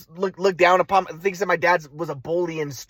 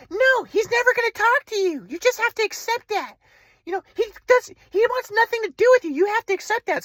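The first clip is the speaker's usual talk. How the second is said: mom's voice; 12 semitones higher than usual. Retold or imitated. Mother voiced on phone.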